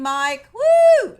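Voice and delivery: loud, high whoop of a name; excited cheer. Real, not acted. Welcoming a viewer in the chat, in a loud whoop. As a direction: cheer him in like a party guest.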